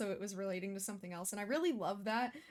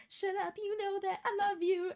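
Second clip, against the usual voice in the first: higher, sing-song lovestruck line; swooning quote; 9 semitones higher than usual. Retold or imitated. Reactor quoting a lovestruck show character, high and sing-song. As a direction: toss it off like a smitten cartoon lover.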